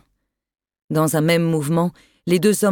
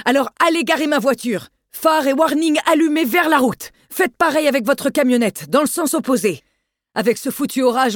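bawling orders; 6 semitones higher than usual. Acted. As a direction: bark the instructions at him. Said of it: "Vallon lui tendit une clé et brailla".

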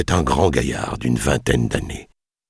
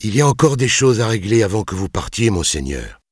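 ordinary delivery, nothing marked

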